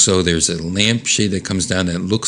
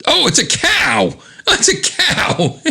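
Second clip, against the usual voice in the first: high-pitched burst of delighted surprise; excited discovery; slower than usual. Real, not acted. Spotting what the picture says under Mom, excited. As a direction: exclaim it much higher, laughing at the end.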